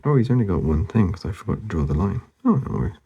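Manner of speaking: normal talking voice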